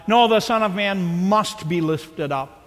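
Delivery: "No" and "must" struck firmly; emphatic must. Shown as a demonstration. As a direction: settle it with a firm "must". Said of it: "Did you catch how emphatic he was?".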